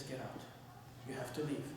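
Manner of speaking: low, soft order to leave; hushed, understated firmness; quiet dismissal. Retold or imitated. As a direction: send the student away under your breath. Sheikh quietly repeats the dismissal.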